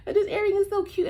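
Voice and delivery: suddenly high, loud delight over a cute earring; bright burst of delight; excited gush. Real, not acted. Gushing over earring mid-story.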